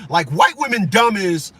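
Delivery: usual speaking voice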